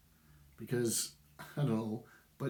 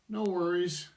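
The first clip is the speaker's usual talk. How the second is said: mock reply; 7 semitones higher, 4 dB louder than usual. Acting out bored replies.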